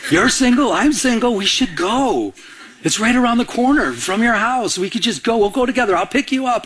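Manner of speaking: acting out a keen friend; eager urging. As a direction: talk a reluctant pal into going. Retold friend eagerly urging him along.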